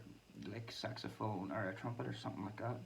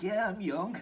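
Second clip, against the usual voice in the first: laughing; 9 semitones higher, 7 dB louder than usual. Retold or imitated. Joking retort while laughing.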